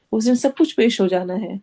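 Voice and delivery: ordinary delivery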